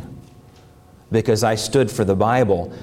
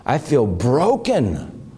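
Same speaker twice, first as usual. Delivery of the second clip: pleading retold prayer rising higher toward the end; broken prayer; 10 semitones higher than usual. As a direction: lay out the despair to God, climbing in pitch. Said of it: "and I said".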